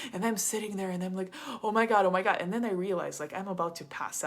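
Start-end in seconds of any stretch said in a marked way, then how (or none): 1.6-2.4 overwhelmed exclaim. Retold or imitated. Overwhelmed while he unloads.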